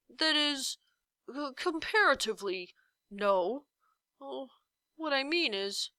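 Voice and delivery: hedging giant voice; can't commit to any word; dithering giant. A narrator's reading. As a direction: indecisive giant hedging every answer.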